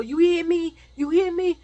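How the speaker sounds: high, loud, repeated challenge; insistent demand. Retold or imitated. Man threatening the woman he wants, loud and insistent. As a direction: press it hard, demanding an answer.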